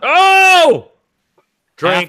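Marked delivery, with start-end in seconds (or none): 0.0-0.9 surprised. Real, not acted. Reacting to the wrong ruling.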